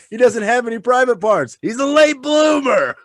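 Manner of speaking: high, loud protest, slowing at the end; hammed-up indignant defence, tipping into laughter; arguing movie parent. Parent defending their kid Glenn, high-pitched mock outrage.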